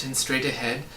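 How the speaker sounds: plain reading voice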